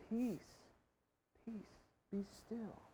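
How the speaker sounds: very soft, with long pauses; quiet, spacious calming; soothing command. Acted. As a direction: hush it gently, word by word. Jesus calming our deepest anxieties.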